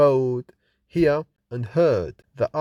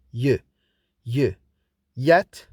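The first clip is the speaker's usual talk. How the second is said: ordinary talk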